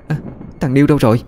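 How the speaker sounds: a startled question without much volume; alarmed cry. A narrator's reading. Mother discovers her son is missing, mildly alarmed cry. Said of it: "Chị la lên".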